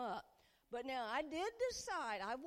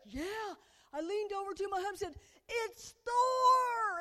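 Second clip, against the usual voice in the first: thrilled fan; 9 semitones higher, 10 dB louder than usual. Retold or imitated. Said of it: "one lady's like".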